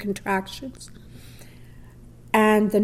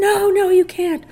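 higher, louder alarmed refusal; 10 semitones higher, 5 dB louder than usual. Retold or imitated. Husband refusing his wife in the street, alarmed and louder. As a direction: stop her, alarmed.

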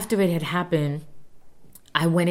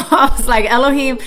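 louder, laughing call of his name; 8 semitones higher, 10 dB louder than usual. Real, not acted. Mother to her son who told her to leave, laughing.

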